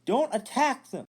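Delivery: high, loud pleading shout; Finn yells. Retold or imitated. Finn yelling at fellow troopers to stop attacking civilians. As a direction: yell to stop the beating. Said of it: "Then Finn starts yelling".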